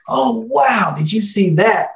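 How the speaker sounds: high-pitched mock crowd gasp of wonder; excited amazement. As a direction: imitate stargazers thrilled by a sudden flash. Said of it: "the whole field lights up with excitement".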